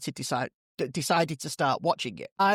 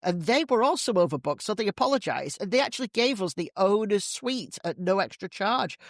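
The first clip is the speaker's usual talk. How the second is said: higher, bragging, delighted; smug woman; 4 semitones higher than usual. Acted. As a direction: gloat about the perfect trip. Woman brags in joke.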